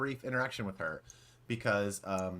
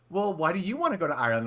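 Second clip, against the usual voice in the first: higher, louder mock European accent; vague European accent; 8 semitones higher, 6 dB louder than usual. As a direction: imitate a host quizzing an audience member. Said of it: "a vague European accent".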